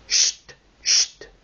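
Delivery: whispered shush; hushing. Acted. Whole party hushing each other before the music, whispered. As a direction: hiss a sharp shush for silence.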